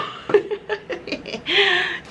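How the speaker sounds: drawn-out high cackle; long high laugh. Real, not acted. Still laughing at the story, long high laugh.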